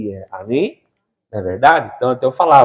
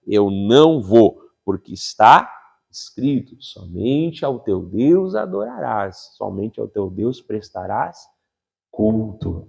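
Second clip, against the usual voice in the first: solemn quoting; 5 semitones lower, 4 dB quieter, slower than usual. Acted. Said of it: "Mas ele dá a palavra".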